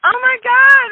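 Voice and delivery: excited outburst. Real, not acted. Host thrilled by the guest's proposal story, loud excited outcry. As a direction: burst out, thrilled and touched.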